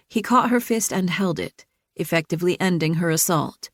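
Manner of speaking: ordinary delivery, nothing marked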